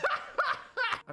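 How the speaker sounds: high shriek into laughing protest. Real, not acted. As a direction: laugh in disbelief. Shocked at the dare.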